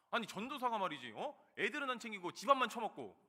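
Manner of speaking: imitated angry scolding. Church member berating the evangelist over the children, imitated angry scolding. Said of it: "갑자기 저한테 막 소리를 지르시니까".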